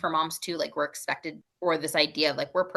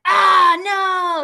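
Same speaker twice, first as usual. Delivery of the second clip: hammed-up loud cry of dismay, higher; dramatic fake dismay; 13 semitones higher, 10 dB louder than usual. Mom hams up losing at bowling for her daughter, mock loud dismay. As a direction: play it big, a mock tantrum at bowling. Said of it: "I was just being dramatic".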